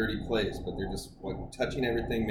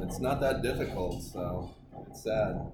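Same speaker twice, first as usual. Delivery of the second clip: ordinary talk, nothing marked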